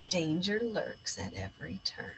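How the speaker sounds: unvoiced ominous whisper; spooky whisper. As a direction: whisper it like danger is near. Spooky warning line.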